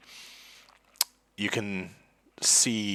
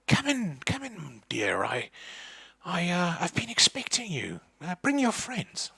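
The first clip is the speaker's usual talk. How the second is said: put-on much higher, fussy old lady welcome; old woman's voice; 10 semitones higher than usual. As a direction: greet them like a doting old hostess. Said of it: "And she's like".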